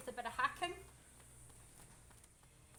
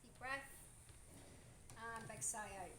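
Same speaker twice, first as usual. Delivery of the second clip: quiet, soothing breathing cue; soft calm guidance; 3 semitones lower, 8 dB quieter than usual. Real, not acted. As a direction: talk the client down gently. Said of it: "guiding client's breathing softly".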